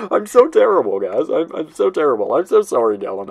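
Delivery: giggly apology. Real, not acted. Laughing at own impression.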